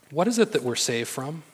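ordinary talk, nothing marked

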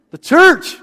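preacher shouting to stir the congregation; shouted out. Real, not acted. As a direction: belt it out to rouse the room. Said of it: "Shout it out".

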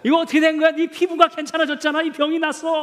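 high-pitched amazed exclamation; astonished. Acted. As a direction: exclaim in disbelief at a sudden healing. Interpreter acting astonished leper seeing healing.

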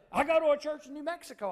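high, loud, over-eager mimicry; out-there Southern Baptist. Man loudly declares his faith to seatmate, hammed-up out-there voice. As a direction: belt it out as a hammed-up zealous Baptist. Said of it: "he was real out there".

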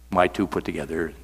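ordinary delivery

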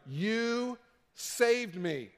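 very slow, drawn-out, heartfelt thanks; awed gratitude. Retold or imitated. Believer tells his savior in slow, overwhelmed gratitude. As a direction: breathe it out like a grateful survivor.

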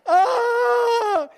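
high, loud alarmed "ah"; mock mother's scream. Husband imitates his wife at the boys' game, shrieking. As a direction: shriek like a panicked football mom. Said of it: "she was like this".

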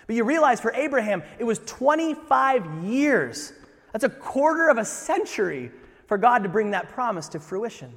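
loud, high, urgent preaching; rising sermon fervor; preaching at full force. Acted. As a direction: drive the point home to the crowd.

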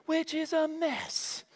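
high, slow, drawn-out sing-song reproach; chiding mother. Retold or imitated. Mom telling two girls to clean playroom, chiding. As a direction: play a mom pointing out the mess, pitch up.

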